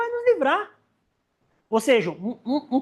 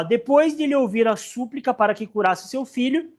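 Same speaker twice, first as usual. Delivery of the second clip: ordinary talk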